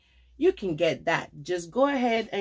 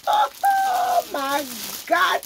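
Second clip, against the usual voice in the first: high laugh through the words; giggly high oh my; laughing squeal; 4 dB louder, much slower than usual. Real, not acted. Laughing over the coat.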